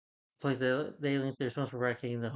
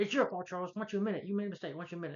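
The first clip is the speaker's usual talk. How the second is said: a higher, mock-angry retelling of Beast blaming Charles; angry accusation; 6 semitones higher, much faster than usual. Recapping Beast blaming Charles for Jean, mock angry. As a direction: pitch it up and press the accusation, half-acted.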